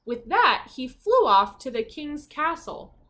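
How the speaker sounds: lively, expressive storybook narration; reading to children. A narrator's reading. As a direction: read it like a bedtime picture book.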